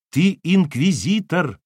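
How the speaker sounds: high, loud, drawn-out accusation; blurted accusation. Acted. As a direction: throw the charge in his face. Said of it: "в лицо ему выпалила Кира".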